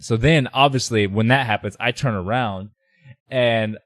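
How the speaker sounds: normal talking voice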